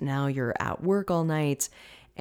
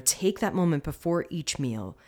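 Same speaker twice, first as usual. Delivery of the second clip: ordinary talk, nothing marked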